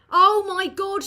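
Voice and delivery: loud, high-pitched "oh my God" outburst; exclaiming. Real, not acted. Streamer reacting out loud to a sudden mishap, exclaiming. Exclaims at something going wrong.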